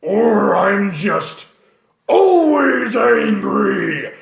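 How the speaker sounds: very slow, loud raging bellow; angry half-orc. Shown as a demonstration. Half-orc raging at everyone, slow loud bellow. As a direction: roar it like you're always furious. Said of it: "half-orcs, you are always angry".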